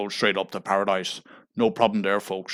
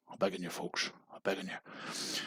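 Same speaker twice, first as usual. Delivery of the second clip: breathy whispered plea, much softer; whispered begging; 14 dB quieter than usual. Acted. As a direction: whisper your desperate begging to the folks. Begging viewers for money.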